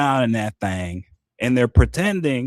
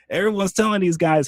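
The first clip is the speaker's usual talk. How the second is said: usual speaking voice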